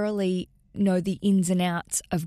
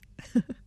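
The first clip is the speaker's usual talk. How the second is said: short nervous giggle; nervous laugh. Real, not acted. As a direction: laugh it off awkwardly. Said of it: "that was a nervous theft laugh".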